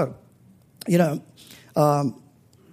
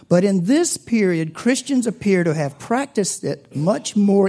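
measured, slightly raised reading voice; formal reading voice, a touch louder; formal quote reading; 4 semitones higher, 4 dB louder than usual. Acted. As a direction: read the passage out to the congregation with weight. Reads a historian's quote aloud.

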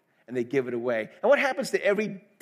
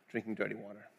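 fading; 8 semitones lower, 14 dB quieter, faster than usual. Real, not acted. Repeated phrase fading away.

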